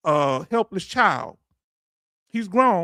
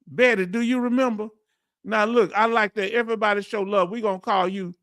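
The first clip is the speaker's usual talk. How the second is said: ordinary talk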